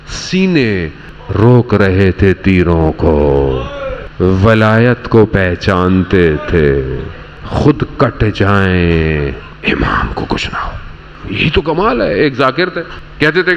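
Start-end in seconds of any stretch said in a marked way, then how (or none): 0.1-9.4 slow heavy emphasis; slow emphatic build. Acted. Climax of sacrifice description. 9.7-10.7 breathy whisper, far lower; whispered devotion; whispered vow. Acted. Companions' inner vow.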